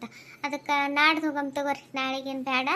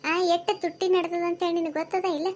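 ordinary talk, nothing marked

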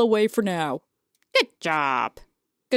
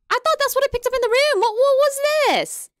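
rushed, higher puzzled voice; fast, high confusion; 12 semitones higher, 3 dB louder than usual. Real, not acted. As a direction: blurt out your confusion. Confused at the picked object.

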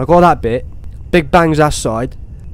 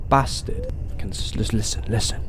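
breathy whispered curse under the breath; 6 semitones lower, 10 dB quieter than usual. Real, not acted. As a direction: hiss it so the monster won't hear. Tense horror game, hiding.

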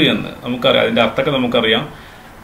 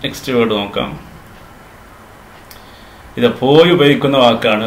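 normal talking voice

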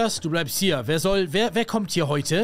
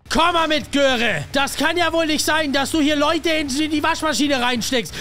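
angry scolding; 5 semitones higher than usual. Acted. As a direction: tear into the sister. Schimpft die Schwester aus. (wütend schimpfend, laut und höher)

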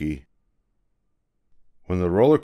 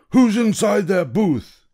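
high, louder demanding shout; angry ticket seller; 12 semitones higher, 5 dB louder than usual. Acted. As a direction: seller furious at the intruder.

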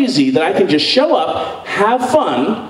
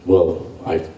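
smooth self; 11 semitones lower, faster than usual. Retold or imitated. Himself voiced smooth.

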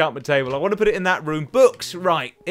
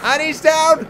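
loud, high victory call; exultant announcement of the kill; triumphant shout; 12 semitones higher, 9 dB louder, slower than usual. Real, not acted. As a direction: crow over a downed enemy. Enemy falls in game.

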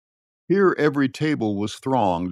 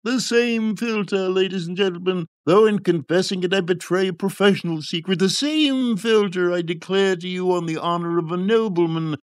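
high, grandiose showman's oratory; high-pitched charlatan's pitch, swelling with flourish; quack's harangue; 7 semitones higher than usual. A narrator's reading. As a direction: stretch key words for the crowd. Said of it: "the quack took up his harangue".

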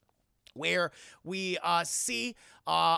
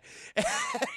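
high laugh. Real, not acted. After the guard is shot.

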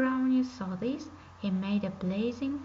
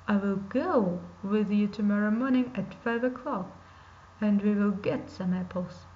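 a louder, sly wolf character; 3 semitones lower, 4 dB louder than usual. Acted. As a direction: voice the scheming wolf, a bit louder.

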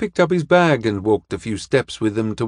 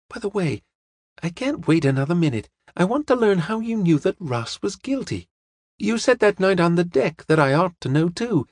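put-on high-pitched girl, eager to find out; impatient young woman; 7 semitones higher than usual. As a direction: plead for the answer in a high, lively voice.

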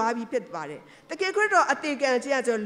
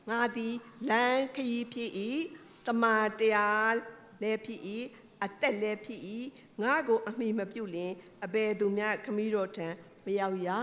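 solemn scripture quote; 5 dB quieter than usual. Acted. Quotes Jesus' words, scripture.

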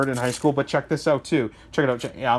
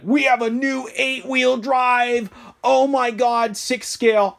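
mock hype announcement; 7 semitones higher, slower than usual. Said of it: "somebody to be like".

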